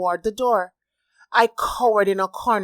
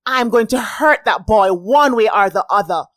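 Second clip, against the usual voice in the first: louder, hard fuming threat; 3 semitones higher, 7 dB louder than usual. A narrator's reading. Molly fuming about the boy, angry threat. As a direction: threaten him through clenched anger. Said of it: "Molly fumed".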